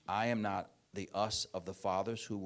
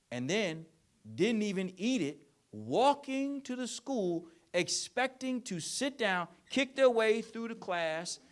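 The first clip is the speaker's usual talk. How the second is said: sarcastic high raised voice; mocking rant; 10 semitones higher, 7 dB louder than usual. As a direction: ham up the entitled parent's logic. Mocking the parents' excuses.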